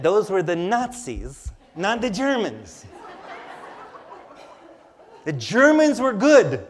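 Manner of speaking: lightly imitated German accent, laughing; a hint of a patient German mother, through laughter. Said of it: "in her German accent".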